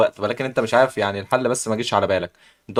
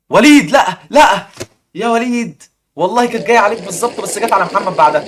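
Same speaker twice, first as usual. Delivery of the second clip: excited outcry; 7 semitones higher, 5 dB louder than usual. Real, not acted. Wheel narrowly misses Waleed.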